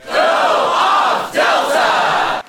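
breathy, high shouted cheer; hype cheer. Acted. Fan cheering the host on, loud and high.

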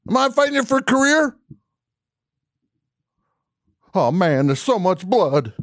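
high, loud mock screaming; retold screaming. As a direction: yell it up high, hammed-up. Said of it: "I started screaming at her".

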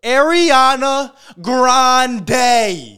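slow, drawn out, loud reveal; dramatic reveal. Acted. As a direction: [name] stretched out slowly and loudly.

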